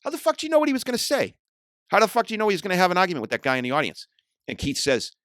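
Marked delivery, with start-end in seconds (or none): 0.0-4.1 fast, higher, louder incredulous challenge; retold angry demand. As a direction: fire the questions at him fast and high. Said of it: "I said to Keith".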